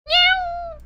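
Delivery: sharp, very high meow; toy meow. Acted. The plushie suddenly meows, very high and loud.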